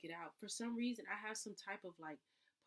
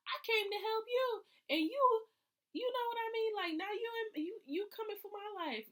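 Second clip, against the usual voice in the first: much higher, louder wounded whine; childish protest; 9 dB louder than usual. Pretend. To the inspection that failed her car, much higher wounded whine. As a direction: plead like a hurt kid who tried to help. Voicing her hurt feelings as quote.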